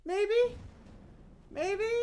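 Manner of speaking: loud, very high questioning squeak; high hopeful squeal. Retold or imitated. Player retelling their hope mid-swing, high-pitched squeal.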